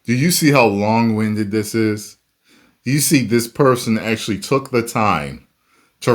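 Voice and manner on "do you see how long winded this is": usual speaking voice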